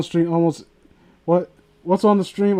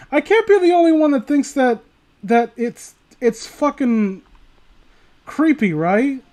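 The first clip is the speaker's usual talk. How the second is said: exasperated disbelief; 7 semitones higher than usual. Real, not acted. Exasperated rant about creepy edits.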